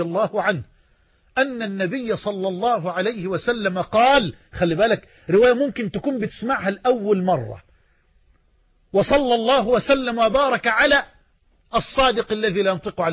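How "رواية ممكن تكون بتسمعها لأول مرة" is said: usual speaking voice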